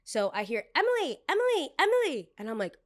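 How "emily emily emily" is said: high, loud mimicked call for Emily. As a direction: holler the name across a dining room. Table calling server across room.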